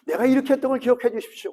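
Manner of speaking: brisk preacher's quote; quick recap. Shown as a demonstration. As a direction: rattle off 내가 이렇게 했던 걸 as a recap. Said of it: "기도가 두 개입니다".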